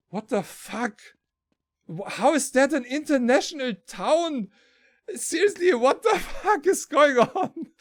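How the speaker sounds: high-pitched mock outrage with laughter; retold exasperated bafflement. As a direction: act out baffled disbelief, pitch climbing. Retold bafflement outside the station.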